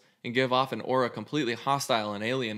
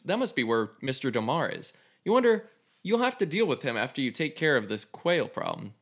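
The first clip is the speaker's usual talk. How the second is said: normal talking voice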